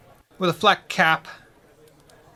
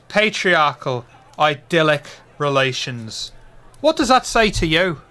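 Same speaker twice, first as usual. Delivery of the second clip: louder, higher declaiming barfly; Irish pub regular; 5 semitones higher, 6 dB louder than usual. Acted. Friend repeats the quote.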